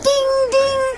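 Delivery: high sung ding like a little bell. A narrator's reading. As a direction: ring it out high and bright. Train bell sound in story.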